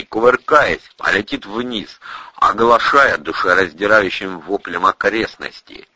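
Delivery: usual speaking voice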